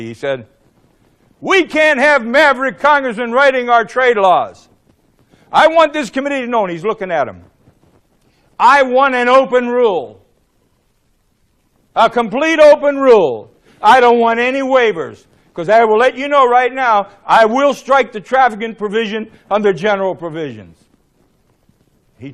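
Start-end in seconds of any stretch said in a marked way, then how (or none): 1.4-6.6 high, emphatic angry declaration; livid chairman. Retold or imitated. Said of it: "and he's livid". 8.6-20.8 loud, high angry ultimatum; worked-up chairman. Retold or imitated. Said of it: "He's getting all worked up".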